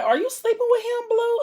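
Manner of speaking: high, sharp accusing question; shrill accusing. Retold or imitated. Accuses her friend of sleeping with him.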